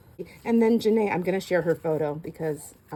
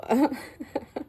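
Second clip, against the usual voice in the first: giggle; 6 semitones higher than usual. Real, not acted. Laughs after showing family card.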